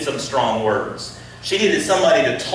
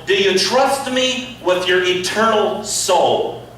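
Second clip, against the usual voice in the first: preaching at full force; 7 semitones higher, 4 dB louder than usual. Acted. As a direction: press her heart for real faith. Preaching Jesus' challenge to Martha.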